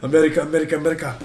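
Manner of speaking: put-on loud, high Indian accent chant. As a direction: shout it in a hammed-up Indian accent. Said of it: "I was doing an Indian accent".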